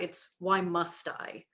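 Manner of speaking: ordinary delivery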